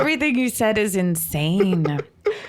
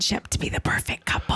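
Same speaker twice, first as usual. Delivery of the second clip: whispering; 6 dB quieter than usual. Real, not acted. Gossiping whisper.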